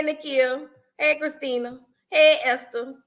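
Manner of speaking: ordinary talk, nothing marked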